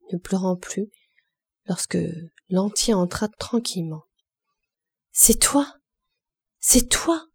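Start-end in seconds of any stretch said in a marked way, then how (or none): 5.1-7.2 high, rising cries of relief; calling out his name in shock; cry out. A narrator's reading. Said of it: "cria-t-elle".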